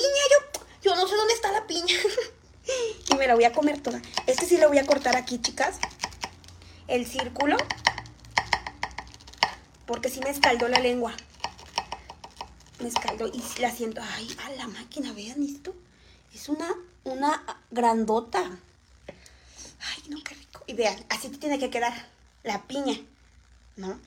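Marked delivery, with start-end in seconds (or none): 0.0-2.4 mock innocent. Playing innocent, mock denial. 19.8-20.6 whispered. Real, not acted. Savoring food, whispered.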